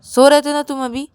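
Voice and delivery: loud, commanding order; stern scolding. Acted. Rahul orders Ravi to sleep, stern and loud. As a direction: order them firmly, raising your voice.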